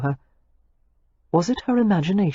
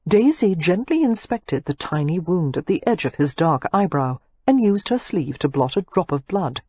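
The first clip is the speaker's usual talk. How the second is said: plain reading voice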